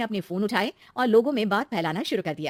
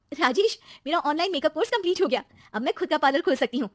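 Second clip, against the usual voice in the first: higher-pitched, eager wife; excited young wife; 8 semitones higher than usual. Acted. Wife telling husband about finished course, excited. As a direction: announce your news brightly. Wife shares good news.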